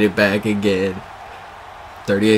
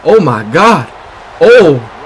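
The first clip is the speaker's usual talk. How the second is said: loud, high whoops of disbelief; thrilled exclamation; 5 semitones higher, 10 dB louder, slower than usual. Real, not acted. Streamer celebrates a touchdown in his game, loud and thrilled. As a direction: exclaim it slowly and loudly, swooping up high.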